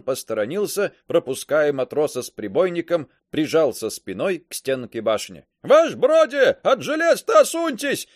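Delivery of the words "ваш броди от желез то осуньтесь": high, loud urgent shout; yelling. A narrator's reading. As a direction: yell the warning. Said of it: "снова заорал Каблуков".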